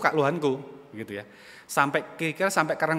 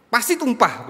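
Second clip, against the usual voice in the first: high, raised-voice mock reproach; retold scolding; 8 semitones higher, 6 dB louder than usual. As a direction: reenact an exasperated father's telling-off. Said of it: "nadanya tinggi".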